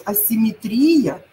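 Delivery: асимметрия said slowly, stress on МЕ; slow careful stress. Shown as a demonstration. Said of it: "вот запомните". (асимМЕтрия, медленно)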